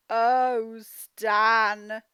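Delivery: sympathetic oh Stan. Acted. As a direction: feel sorry for Stan out loud. Reading a story to children.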